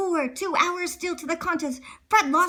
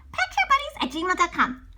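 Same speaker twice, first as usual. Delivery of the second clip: plain reading voice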